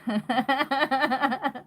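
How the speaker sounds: a loud, high burst of real laughter; incredulous laugh. Real, not acted. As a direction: laugh out in disbelief. Laughs at being called mercenary. (risada alta de incredulidade)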